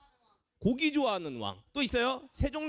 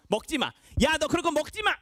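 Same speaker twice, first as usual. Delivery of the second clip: exasperated telling-off, building higher and louder; scolding mum; 6 semitones higher, 5 dB louder than usual. Acted. Mother giving up on picky child, rising scolding. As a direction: snap at the child like a fed-up mother. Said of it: "잔소리를 했겠죠".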